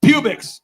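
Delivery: Publix said as "PYOO-bix"; mispronounced store name. Retold or imitated. Oblivious speaker repeating his sponsor's name, Publix mispronounced as PYOO-bix. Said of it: "you kept saying pubic".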